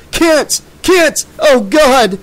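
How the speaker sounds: high, loud, desperate cries; anguished bellow. A narrator's reading. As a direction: bellow in horror at finding your son. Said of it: "he bellowed".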